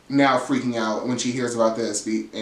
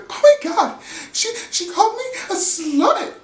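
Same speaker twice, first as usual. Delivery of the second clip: much higher, louder shocked squeal; offended shriek; 3 dB louder than usual. Retold or imitated. Brittany outraged at Angie, high scandalized squeal.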